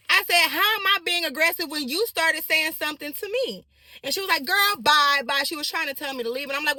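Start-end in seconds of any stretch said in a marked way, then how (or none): 0.5-3.7 higher, louder, indignant comeback; indignant retort. Retold or imitated. Arguing back at the driver, louder and indignant. 4.4-5.4 put-on louder, sassy girl bye; mock dismissive. The driver dismissing her, loud and sassy.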